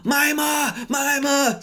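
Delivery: high, loud shout across the airport; calling to auntie far away. Retold or imitated. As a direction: yell the name across the hall. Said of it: "My auntie's called Mima".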